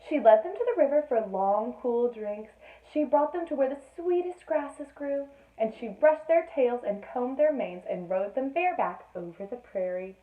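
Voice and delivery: lively read-aloud storytelling voice; storybook reading. A narrator's reading. Mother reading a bedtime story to Sophie, lively. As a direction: read the tale to a small child.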